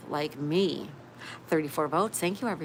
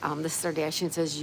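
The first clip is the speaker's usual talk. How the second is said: ordinary talk, nothing marked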